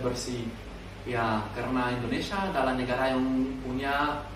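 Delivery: Indonesian spoken with a French accent; a French learner speaking careful Indonesian. Real, not acted. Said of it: "aku asli Perancis".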